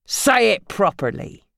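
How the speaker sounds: slow, firm order; stern command. Acted. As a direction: order her coldly. Phil commanding.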